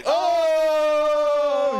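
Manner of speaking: long, high loud wail; drawn-out "oh" cry; loud exclamation. Real, not acted. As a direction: draw it out in a high shout. Exclaiming at what is shown.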